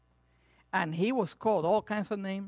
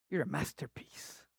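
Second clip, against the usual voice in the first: hushed, lower and gentle; soft tender voice of God; 4 semitones lower, 8 dB quieter than usual. Acted. Preacher voicing God's praise to the congregation, softly. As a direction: say it like a quiet blessing.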